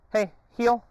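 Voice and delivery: high, loud call to the dog; sharp command. Real, not acted. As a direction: snap the command out.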